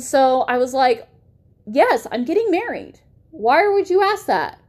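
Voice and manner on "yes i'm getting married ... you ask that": higher, sharper exasperated answer; irritated reply. Retold or imitated. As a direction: push back hard at the ex. Answering the ex at night.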